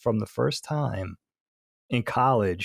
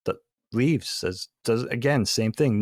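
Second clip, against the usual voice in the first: ordinary delivery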